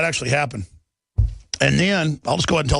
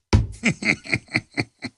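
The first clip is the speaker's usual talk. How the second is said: breathy laugh; 5 semitones higher than usual. Real, not acted. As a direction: laugh it off under your breath. Laughing after his story.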